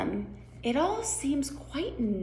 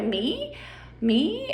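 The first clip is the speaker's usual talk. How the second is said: rising, higher-pitched question; questioning Alice; 7 semitones higher than usual. Acted. Alice questions the Queen's choice, pitch climbing. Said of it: "Alice is gonna go".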